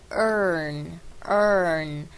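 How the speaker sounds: "earn" slowed with a long r-colored er; slow er sound. Shown as a demonstration. Teacher slowly drills "earn" from "learn". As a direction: stretch the er of "earn". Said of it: "learn是earn".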